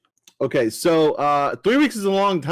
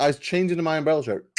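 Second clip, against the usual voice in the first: ordinary delivery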